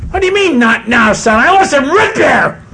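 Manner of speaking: put-on boy whines at his father, high; whiny brat. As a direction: drag it out like a sulking boy. Boy demands again.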